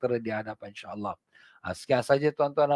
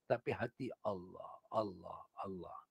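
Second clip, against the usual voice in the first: much softer, lower murmured Allah; silent heart dhikr; 4 semitones lower, 16 dB quieter than usual. Shown as a demonstration. Preacher showing dhikr of the heart with the tongue still, hushed. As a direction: breathe the names of Allah as if inside the heart. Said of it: "lidah diam".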